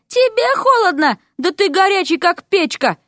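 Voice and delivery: high teasing frog voice; mock-surprised frog girl scoffing at his cold; frog Vasya, teasing. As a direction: pitch it up and tease him for being hot.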